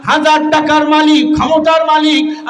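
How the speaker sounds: high, sustained preaching cry; impassioned fundraising. Real, not acted. Preacher asking for cash or pledges, impassioned high appeal. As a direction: press the appeal on a high held pitch.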